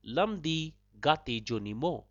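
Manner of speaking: "Lam di gate joni mo" said slowly in word groups; slow teaching take of "Lam di gate joni mo", brief gaps between words. Shown as a demonstration. Podcast host repeats the Dzongkha road question for learners, slowly. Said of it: "So one more time slowly".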